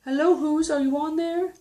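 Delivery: high-pitched searching call; elephant calling out. Acted. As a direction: call hopefully into each flower. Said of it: "he picks them up and goes".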